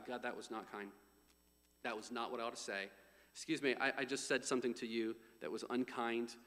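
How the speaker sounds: soft, low, humble apology; quiet confession. Acted. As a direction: drop right down low and quiet to own up. Models private confession and apology.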